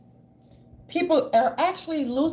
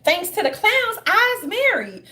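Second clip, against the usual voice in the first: high, louder mock fan voice; mock grateful fan; 7 semitones higher, 4 dB louder, slower than usual. Follower thanking the clowns, high loud mock gushing. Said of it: "people saying".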